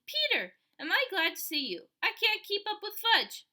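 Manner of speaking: high, loud, relieved rush; worn-out mom glad to see her son; relieved mother. Acted. Mother, panting after Fudge, relieved to see Peter.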